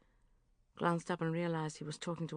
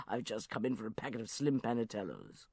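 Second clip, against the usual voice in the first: lightly voiced uncle; 6 semitones lower, 3 dB quieter than usual. Geoffrey excusing himself.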